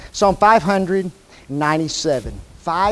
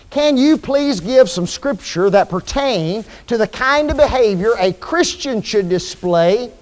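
ordinary talk

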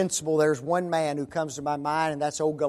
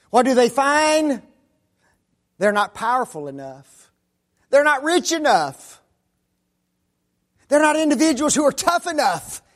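preaching at full force; 8 semitones higher, 7 dB louder than usual. Acted.

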